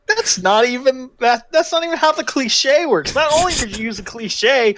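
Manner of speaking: high, loud exasperated rant. Real, not acted. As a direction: rant in disbelief, voice climbing. Ranting about the cliche.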